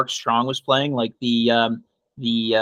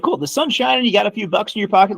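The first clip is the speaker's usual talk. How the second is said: high-pitched, upbeat put-on voice; mock cheerful prompting; 8 semitones higher than usual. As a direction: pitch it up, playing the optimist. Voicing cheerful side of mock exchange.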